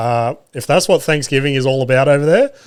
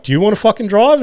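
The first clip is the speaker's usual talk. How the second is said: exasperated retort; 8 semitones higher, faster than usual. Retold or imitated. Driver snaps back at wife.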